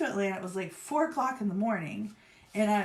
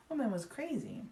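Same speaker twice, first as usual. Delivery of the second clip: ordinary talk, nothing marked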